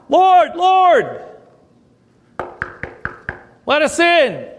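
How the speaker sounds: high, loud cry from outside; acted pleading call. Shut-out virgins beg the Lord to open, high pleading call. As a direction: call out, drawing the words long.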